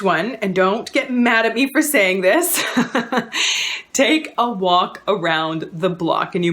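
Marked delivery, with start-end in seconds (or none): 2.7-3.9 sheepish laugh. Real, not acted. Sheepish laugh before unpopular advice.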